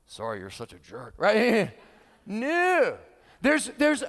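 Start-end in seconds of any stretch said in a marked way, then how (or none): 0.0-1.1 put-on low, soft snide kid's sorry; grudging kid. Preacher mimicking a kid apologising to a sibling with a low, grudging sorry. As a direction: mutter the sorry then slip in the insult. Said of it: "like kids going".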